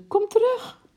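very high, loud plea; acted panicked scream; shrieking. A narrator's reading. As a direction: shriek to call the husband back. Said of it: "gilde ze".

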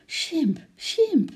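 high, falling frightened calls; alarmed cry. A narrator's reading. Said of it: "riep Lotje verschrikt". (verschrikte hoge uitroep)

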